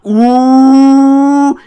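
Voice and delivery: high loud crowd ooh; imitated class ooh. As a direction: hoot like a whole classroom.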